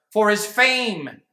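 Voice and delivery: ordinary delivery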